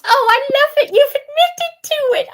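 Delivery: high, gloating delight; gleeful. Real, not acted. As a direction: pounce on it with glee. Gleeful gotcha in a debate.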